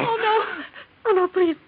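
breaking into a cry; sobbing out a frightened no; crying plea. Acted. Wife pleading with raging husband, crying.

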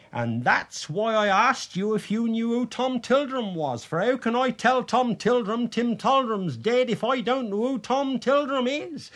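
high-pitched, worked-up plea; agitated explaining. A narrator's reading. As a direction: explain yourself in rising agitation. Agitated sexton explains his question.

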